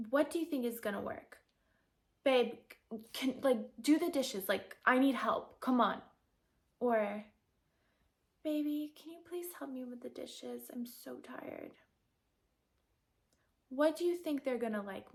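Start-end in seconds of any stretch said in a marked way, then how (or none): 2.2-6.0 loud, pushy demand to the man; aggressive demand. Shown as a demonstration. Wife demanding help with the dishes, loud and pushy. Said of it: "This first one that's aggressive". 8.4-11.7 cute feminine ask. Shown as a demonstration. Wife asking her man for help, soft and cute. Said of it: "playful and cute and feminine".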